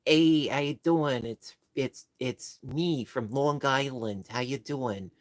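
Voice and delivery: hammed-up Long Island accent, slow and drawn out. Shown as a demonstration. Streamer showing viewers a painfully typical Long Island accent, hammed up. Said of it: "a painfully typical Long Island accent".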